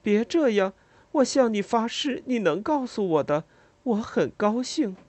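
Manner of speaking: pleading reassurance. Acted. Narrator pleads and swears.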